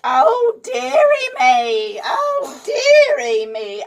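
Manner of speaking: put-on high, slow fretting old woman voice; muttering old nurse. A narrator's reading. Old nurse fretting over the boy, high and slow. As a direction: croon it like a flustered old nurse. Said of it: "she muttered".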